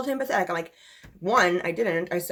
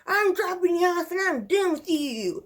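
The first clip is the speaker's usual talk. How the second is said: mocking boyfriend; 10 semitones higher than usual. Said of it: "he would be like".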